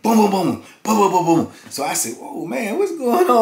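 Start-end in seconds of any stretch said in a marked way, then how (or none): 2.2-3.4 startled. Retold or imitated. Reacting to noise next door.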